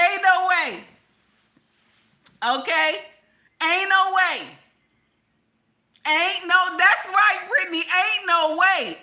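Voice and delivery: high, loud, emphatic refusal to believe it; loud disbelief. Acted. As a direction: belt it out, utterly incredulous. Reacting in disbelief to story.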